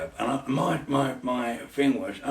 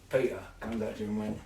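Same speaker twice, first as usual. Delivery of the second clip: usual speaking voice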